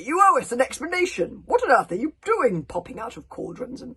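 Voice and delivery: loud high offended demand; indignant bellow. A narrator's reading. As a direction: roar it like a wronged chief. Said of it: "bellowed Stoic the Vast with a strong sense of injury".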